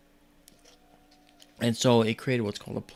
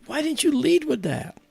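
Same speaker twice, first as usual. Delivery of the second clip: high-pitched mock protest; exasperated disbelief. Protesting to the friend after the reveal, high exasperation. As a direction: demand it, voice pitched way up.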